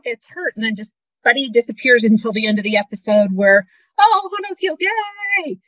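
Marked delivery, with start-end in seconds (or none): 4.0-5.6 much higher, sarcastic fake delight; mock cheer. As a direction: cheer it with heavy irony. Mocking the episode's happy ending.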